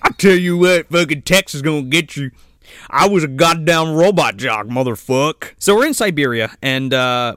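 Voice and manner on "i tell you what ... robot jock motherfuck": put-on Texan accent. Mocking the American pilot Tex.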